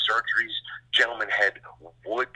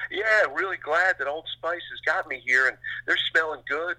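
higher, hammed-up sponsor plug; lightly voiced athlete stuck on his pitch; mock athlete ad read; 7 semitones higher than usual. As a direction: parody a player reciting his sponsor lines. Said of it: "would you talk like this normally".

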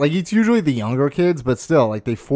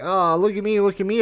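higher, sing-song look at me; mock showing off; 7 semitones higher than usual. Mocking a coworker who brags about selling cookies, preening. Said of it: "get so, like, weirdly".

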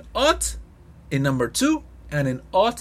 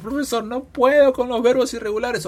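mock student plea; 8 semitones higher than usual. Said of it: "ya que hay muchos alumnos".

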